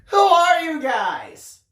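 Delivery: high, slow, loud demand; exasperated shout. A narrator's reading. Daniel loses patience with the family and shouts.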